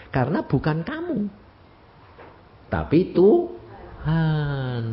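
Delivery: normal talking voice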